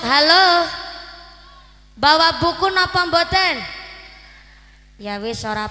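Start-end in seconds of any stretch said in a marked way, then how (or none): 0.0-3.5 raised calling voice; memanggil jamaah. Real, not acted. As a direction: call across to the far corner. Calling to people in the corner. (berseru ke jamaah di pojok)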